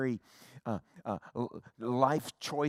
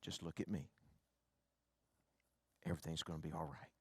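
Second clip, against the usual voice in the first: put-on much softer and lower, gently soothing a scared child; soothing father; 7 semitones lower, 11 dB quieter, faster than usual. Father calming his scared son in a soft low voice. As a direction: hush and calm a frightened little boy.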